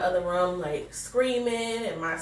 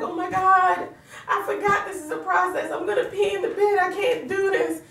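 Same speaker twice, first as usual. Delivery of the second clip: high-pitched, laughing mock panic; retold panicky wail; 11 semitones higher than usual. Pregnant wife telling her husband she can't get up, retold panicky wail. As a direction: wail it much higher, half laughing in dismay. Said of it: "I told my husband, I was like".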